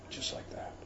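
near-whisper, very soft; hushed emphasis. Real, not acted. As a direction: drop to a whisper for weight. Hushed emphasis on sudden mass death.

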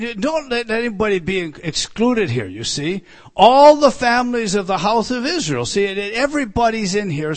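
loud fervent pulpit preaching; preaching at full force. Acted. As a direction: preach it hard to the congregation.

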